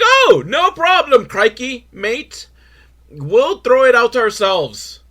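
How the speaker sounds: high, drawn-out mock Australian accent; bad Australian accent. Australian prime minister replying about space junk, bad Australian accent. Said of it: "I don't know how to do an Australian accent".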